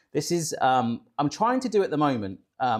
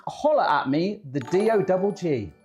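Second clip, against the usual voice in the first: mock rapper sign-off; lightly hammed-up farmer-like rapper voice; 2 semitones higher, 4 dB louder than usual. Host signs off the recipe intro, mildly faked rapper voice. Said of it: "[name] impression in an English farmer voice".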